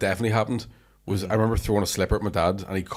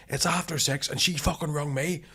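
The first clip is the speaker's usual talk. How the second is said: higher, slower aggrieved retort; acted indignant protest; 6 semitones higher, 4 dB quieter, slower than usual. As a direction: replay a teenager protesting to mum. Acted teenage protest to mother.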